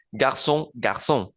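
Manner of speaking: garçon and garçons said alike, slowly; no S heard in garçons; same sound demo. Shown as a demonstration. Teacher showing singular and plural sound the same. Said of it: "suena igual".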